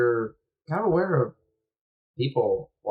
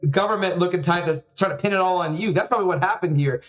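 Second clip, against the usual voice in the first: quick, higher conspiracy-minded father; ranting dad; 4 semitones higher, 3 dB louder than usual. Acted. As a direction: get worked up with suspicion, words tumbling out. Father rants about suspected plot.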